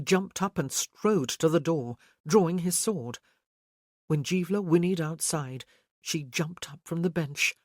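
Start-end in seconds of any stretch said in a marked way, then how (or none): none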